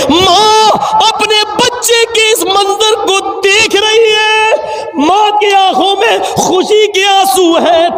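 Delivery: high, slow, crying preacher voice; tearful cry. Real, not acted. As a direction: let the voice break into tears. Preacher moved to tears describing mother.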